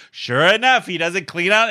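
usual speaking voice

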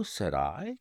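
usual speaking voice

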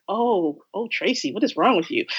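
softly voiced, disapproving viewer; mock dismay. Imagined viewer reacting to Tracy's film pick, mock dismay.

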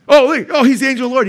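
high, loud imitation of an objector; mock realization. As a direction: blurt it out as a mock discovery. Mimicking an objector's sudden claim.

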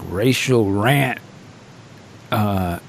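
ordinary talk

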